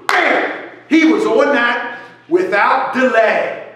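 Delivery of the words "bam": punchy bam. Retold or imitated. Describing Barry acting fast.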